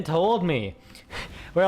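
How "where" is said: usual speaking voice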